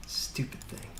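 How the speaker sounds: soft whispered grumble at the screen. Real, not acted. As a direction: whisper it in annoyance, no voice. Muttered frustration at screen.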